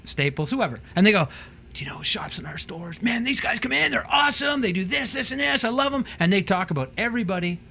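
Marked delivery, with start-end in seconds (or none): 1.8-6.0 much higher, gushing praise of a customer; enthusiastic shop staff. Retold or imitated. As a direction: rave about the guys who shop here.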